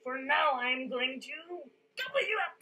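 greedy troll. A narrator's reading. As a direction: relish the gobbling. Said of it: "said the troll".

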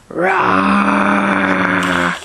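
long, drawn-out pretend bear roar. Parent shows child what a bear does, playful roar. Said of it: "He goes".

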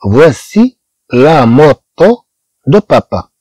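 each word of "voici le père de René" stretched out slowly; slow French model sentence. Shown as a demonstration. Teacher reading the French example slowly to students. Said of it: "الجملة الثالثة".

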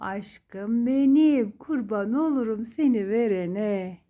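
slow, loud, drawn-out pet talk; doting coo. Real, not acted. Owner repeats the petting and coos at Boncuk, slow and loud. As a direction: pour love into every name, stretching it. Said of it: "Şimdi bir kez daha yapıyoruz boncuğum".

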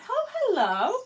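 high, bright sing-song hello; delighted greeting. Real, not acted. Greeting a viewer in chat.

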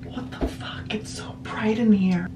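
hushed whispered curse at the light; shocked whisper. Real, not acted. Half-asleep girl whispering in the bright bathroom, stunned.

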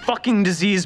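ordinary delivery

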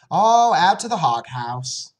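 lightly voiced story mother, drawn out; mother answering. Mother answers her daughter about Papa, slow and loud.

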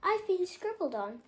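high, crying complaint; upset bird's tearful voice; tearful twitter. A narrator's reading. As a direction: near tears over the scribbles. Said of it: "twittered Muriel".

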